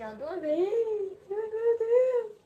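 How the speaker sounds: high, slow, crying ai meu Deus; pained cry. Retold or imitated. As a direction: wince and cry it out. Pulling out the piercing.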